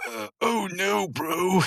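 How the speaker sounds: a hint of a dazed guy lying in the dirt; groaning Muscle Man. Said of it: "Muscle Man's just laying there going".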